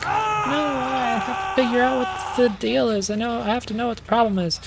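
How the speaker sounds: very high, put-on girlish whine; high-pitched mock pleading, hurrying toward the end; imitated insistent sister. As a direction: mimic a fretful sister in a high voice. Voicing the sister's insistence in recap.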